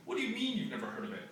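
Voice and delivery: put-on a kid friend teasing the narrator; incredulous school friend. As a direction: rattle it off in mock disbelief.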